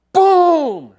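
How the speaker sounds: loud, high "boom!" for a rifle shot; shouted gunshot sound; mock gunshot boom. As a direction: blast it out like a gunshot. Second rifle shot in story.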